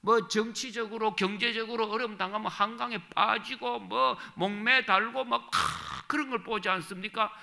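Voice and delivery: loud, high, impassioned sermon; preaching at full force. Acted. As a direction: thunder it from the pulpit. Preacher rails against suicide.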